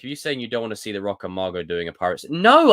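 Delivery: ordinary talk, nothing marked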